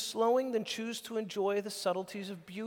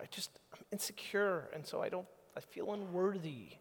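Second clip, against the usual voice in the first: whispered and softer, timid; insecure whisper; 6 dB quieter than usual. Acted. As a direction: confess it in a hushed voice. Voicing an insecure person.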